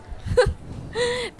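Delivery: high bright laugh bursting out; burst of laughter. Acted. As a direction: laugh out loud at the joke. Friend laughs at bestie's joke.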